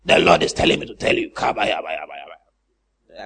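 low, soft mock speaking in tongues; low hushed gibberish prayer. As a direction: parody a showy preacher babbling. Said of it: "then you talk like that".

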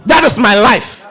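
high, loud declaration of success; preaching at full force. Acted. Preacher declaring victory over his own life, full force. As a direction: proclaim it like a victory cry from the pulpit.

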